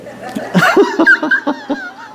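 a big high laugh at herself; a real burst of laughter. Real, not acted. Laughing at own story.

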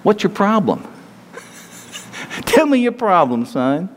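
exasperated challenge. Retold or imitated. Preacher paraphrasing God to Elijah, mock exasperated. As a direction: needle him like an impatient boss. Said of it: "what he was really saying".

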